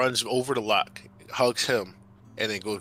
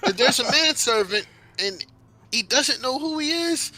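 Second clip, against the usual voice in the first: excited blabbering; 13 semitones higher, 5 dB louder than usual. Acted. Midas telling parents about the Feywild, blabbering excitedly. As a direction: gush about every adventure in a high voice. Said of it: "blabbering on about".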